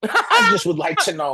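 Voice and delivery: high, loud laughing squeal. Real, not acted. Cohost laughing at the Ric Flair casting, squealing high.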